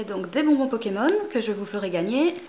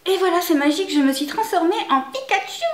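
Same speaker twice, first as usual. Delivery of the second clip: higher and louder with fake glee; higher, louder hammed-up triumph; mock triumphant cry; 8 semitones higher, 7 dB louder than usual. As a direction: announce the transformation like a magical girl. Mock magical transformation announcement.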